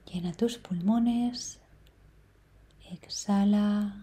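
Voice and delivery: soft meditation voice with long, unhurried pauses; guided meditation. Real, not acted. Meditation guide leading listeners through breathing and number sequence, calm guiding voice. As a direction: guide them gently, leaving space to breathe between phrases.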